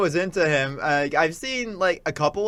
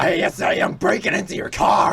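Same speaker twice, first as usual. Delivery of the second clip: mock shout; 3 semitones higher than usual. Quoting meme loudly.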